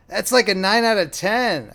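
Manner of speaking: high, loud yelp of pain, drawn out. A narrator's reading. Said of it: "I yelped".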